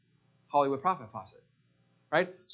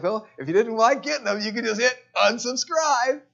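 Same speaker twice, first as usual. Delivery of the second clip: mock retort; 5 semitones higher, 5 dB louder than usual. Mock retort to email complainer.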